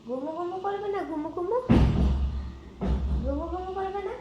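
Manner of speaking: high, sing-song pet talk; cooing high baby talk to a pet; baby talk to the cat. Acted. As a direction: coo at the sleepy kitten in a high voice.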